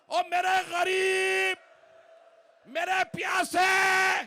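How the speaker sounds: mourning cry over the bier; full-force wailing elegy. Acted. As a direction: wail the lament at full force.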